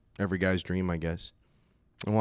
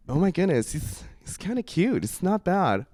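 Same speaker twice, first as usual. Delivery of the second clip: lightly voiced girls, higher pitch; girls' reaction; 6 semitones higher than usual. Girls at a bar reacting to his accent, lightly voiced. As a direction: react as a charmed girl, lightly put on.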